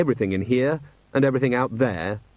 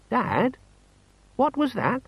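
bewildered son; 12 semitones higher, slower than usual. A narrator's reading.

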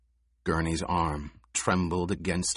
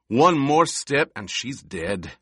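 angry threat; 5 semitones higher, 4 dB louder than usual. A narrator's reading. Gurney threatening with a hostage.

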